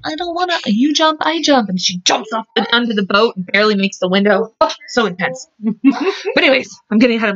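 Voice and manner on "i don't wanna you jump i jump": a hint of a dramatic Rose, pitched higher; mock Rose plea, higher. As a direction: play Rose begging him, half in jest. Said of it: "crazy ass Rose".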